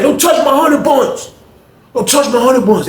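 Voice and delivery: high, pleading mock yell; guarding his stash, hammed up; retold angry yell. Honey bun man yelling at grabbers, high mock yell.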